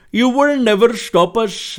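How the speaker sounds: put-on slow, loud, high Indian-accented delivery; Abraham Lincoln, Indian accent. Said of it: "Abraham Lincoln with an Indian accent".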